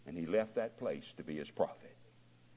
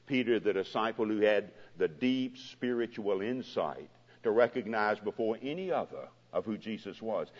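ordinary delivery

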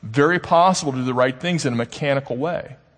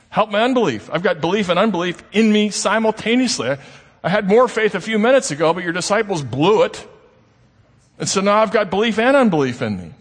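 pleading, pitched up and pressing; a father begging for his son, voice raised in need; desperate plea; 7 semitones higher than usual. Retold or imitated. Father begging Jesus for his son, desperate raised plea.

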